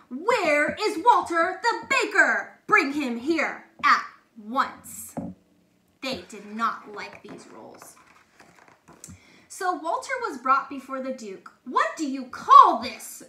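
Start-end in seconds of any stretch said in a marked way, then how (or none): 0.1-5.1 angry demand. A narrator's reading. Duke demands the baker. 11.6-13.2 loud angry roar. A narrator's reading. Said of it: "roared the Duke".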